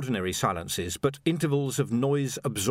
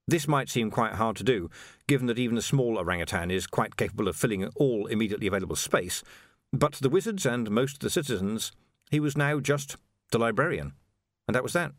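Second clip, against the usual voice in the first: ordinary delivery, nothing marked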